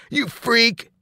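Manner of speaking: high, sharp yell at someone; yelled insult. A narrator's reading. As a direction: yell it as a bully's jeer. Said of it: "Sloan yelled".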